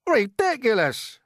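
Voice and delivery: high, loud, drawn-out scoff; shouted. A narrator's reading. Others rejecting James's seagull plan, shouting. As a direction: shout it down in outrage. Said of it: "they shouted".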